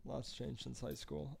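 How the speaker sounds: slow soft movie quote. Retold or imitated. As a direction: murmur the line slowly. Movie line quote again.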